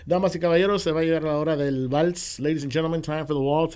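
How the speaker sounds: booming bilingual MC announcement; lucha libre announcer. Retold or imitated. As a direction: announce it big like a ring MC. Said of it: "I'm on the microphone".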